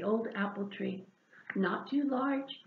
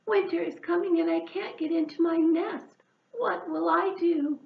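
worried; 6 semitones higher, 4 dB louder than usual. Acted. Hedgehog upset.

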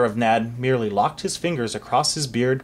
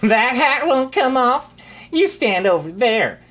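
high, slow mean bratty voice; mean sneer; 10 semitones higher, 5 dB louder than usual. Acted. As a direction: taunt him like a spoiled brat. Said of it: "laughing meanly".

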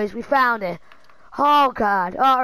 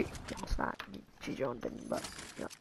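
very soft, low mumble; muttered aside; 11 semitones lower, 19 dB quieter, much faster than usual. Real, not acted. Streamer taking back his boast, muttered aside. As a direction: drop to an under-the-breath retraction.

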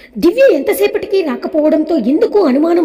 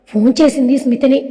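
usual speaking voice

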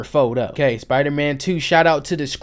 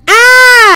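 loud, high-pitched scream; hammed-up shriek of terror; panicked scream; 13 dB louder than usual. Podcast host shrieks as villain attacks, panicked. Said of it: "Look at Jameson screaming".

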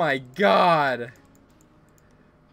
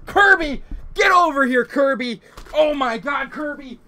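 higher, louder, hammed-up outrage; mock furious yelling; 8 semitones higher, 4 dB louder than usual. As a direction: yell at Kirby like a betrayed rival. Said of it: "why are you not yelling about Kirby killing me".

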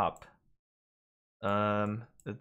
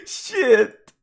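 high cracking laughing curse; 14 semitones higher, 13 dB louder than usual. Real, not acted. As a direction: curse squeaked out mid-laugh. Laughing at own mistake.

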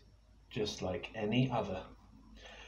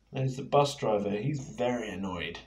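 usual speaking voice

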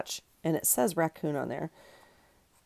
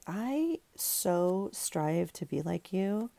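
mock-earnest admiration; 3 semitones higher, 5 dB quieter, much slower than usual. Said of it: "and I'm like".

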